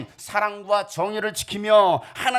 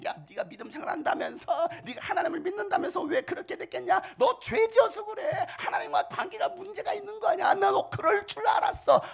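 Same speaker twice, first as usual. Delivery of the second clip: higher, taunting voice with a laugh; mocking friends; 12 semitones higher, 4 dB quieter than usual. Job's friends jeering at him in his ruin, mocking. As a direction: sneer at the fallen man. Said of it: "친구들마저도 와서 조롱합니다".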